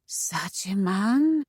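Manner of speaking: put-on slow, high, accented amazement; exclaimed wonder. A narrator's reading. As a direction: marvel softly, stretching the words. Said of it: "Femi exclaimed".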